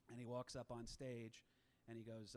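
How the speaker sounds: ordinary talk, nothing marked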